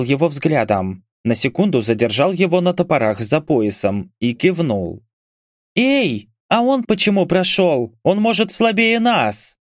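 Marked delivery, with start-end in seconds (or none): 5.7-9.6 high-pitched indignant protest. A narrator's reading. Archer protesting that a stranger got through, indignant. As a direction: object in a much higher voice. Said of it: "возмутилась лучница".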